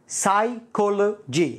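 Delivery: "psychology" very slowly in separated parts, silent p; syllable by syllable. Shown as a demonstration. Teacher breaking down "psychology" for learners, slow and split. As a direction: break "psychology" up as sai, colo, gy.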